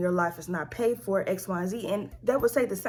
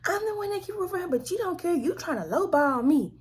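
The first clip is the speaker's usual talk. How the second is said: high, fast imitation of an upset mother; mock spazzing mother; 10 semitones higher than usual. Imitating her mother complaining at her, high and fast. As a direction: mimic her mother's frantic complaint, much higher. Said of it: "She starts spazzing".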